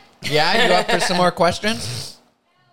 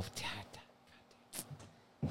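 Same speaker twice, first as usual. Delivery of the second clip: whispered; 9 semitones lower, 28 dB quieter than usual. Real, not acted. Reacting to hot sauce.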